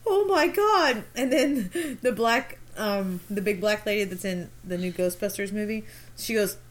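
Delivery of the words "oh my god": high shocked "Oh my God"; mock shocked gasp. Sister retelling the skit mom's shocked reaction, high and surprised. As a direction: blurt it in mock horror. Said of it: "And she goes".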